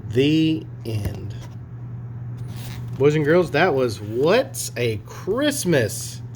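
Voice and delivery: usual speaking voice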